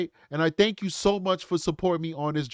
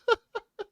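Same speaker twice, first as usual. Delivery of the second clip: laugh. Real, not acted. Host laughs asking chat.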